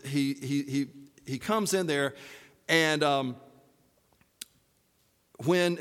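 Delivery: usual speaking voice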